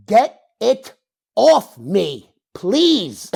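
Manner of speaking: drawn-out, loud, high plea; hammed-up desperate begging, each word stretched out; mock begging. Addict begging to be freed from nose spray, hammed-up begging. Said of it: "I'm crying, I'm begging you".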